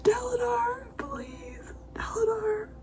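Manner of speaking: high, choked whisper on the verge of tears; crying whisper pleading a character's name; tearful whisper. Real, not acted. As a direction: plead through tears, barely voiced. Emotional reaction while reading.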